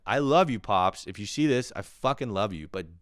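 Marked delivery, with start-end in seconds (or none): none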